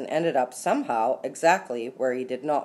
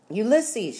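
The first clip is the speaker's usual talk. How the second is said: high-pitched calling out, modestly raised; shouted; 8 semitones higher, 3 dB louder than usual. A narrator's reading. Flora calls to her squirrel over screams, high shout. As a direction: call the name high, as over a din. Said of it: "she shouted".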